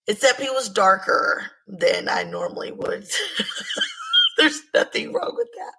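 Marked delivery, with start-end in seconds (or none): 3.1-4.3 high laugh. Real, not acted. Laughs at own remark. 4.4-5.7 laughing. Real, not acted. Laughing disclaimer.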